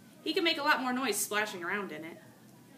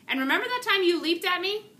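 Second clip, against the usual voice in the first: much higher and louder, full of wounded pride; indignant loud challenge; 10 semitones higher, 9 dB louder than usual. Acted. Soaked hamster tells off the frog, loud and indignant. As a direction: throw it at him like an accusation.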